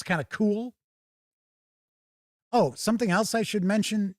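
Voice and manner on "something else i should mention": ordinary talk, nothing marked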